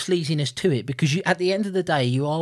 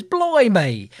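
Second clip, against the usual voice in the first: louder, higher mock British exclamation; British accent; 6 semitones higher, 6 dB louder than usual. Co-host quotes butcher's line, mock British accent. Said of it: "going for a British accent".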